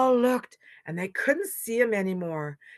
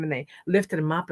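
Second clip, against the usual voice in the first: plain reading voice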